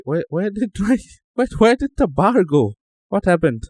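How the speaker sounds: surprised. Real, not acted. Bar vanished suddenly.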